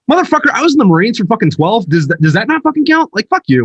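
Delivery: loud, high, fed-up retort at a defender of degrees; motherfucker, I did twelve years, hammed up; indignant retort. Veteran snapping back at people who defend degrees, loud and high. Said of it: "It's like".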